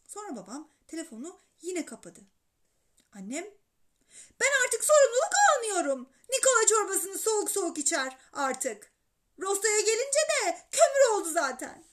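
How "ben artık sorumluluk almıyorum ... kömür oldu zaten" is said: high, loud wifely complaint; exasperated mother. A narrator's reading. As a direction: complain loudly, at the end of your patience. Annem sofrada babaya çıkışıyor. (sinirli, tiz sesle yakınarak)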